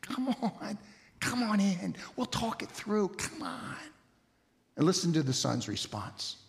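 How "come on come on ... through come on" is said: hushed, half-whispered pleading; very soft, tender private appeal; father entreating. Acted. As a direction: coax him gently in a soft whisper. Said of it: "the father comes out and says".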